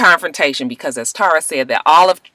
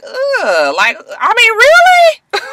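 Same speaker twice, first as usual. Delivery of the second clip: disgusted disbelief; 4 dB louder, slower than usual. Real, not acted. Disgusted reaction to vulgar quote.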